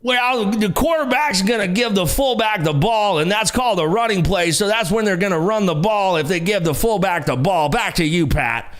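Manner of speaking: hammed-up broadcast color commentary; John Madden. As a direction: state the obvious like a folksy TV analyst. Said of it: "John Madden had the best commentary".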